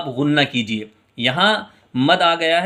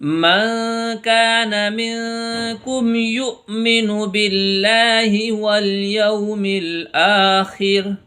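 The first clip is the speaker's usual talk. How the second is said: slow, drawn-out melodic tajweed chant; Quran recitation; 4 semitones higher, much slower than usual. Acted. Teacher reciting verses for students.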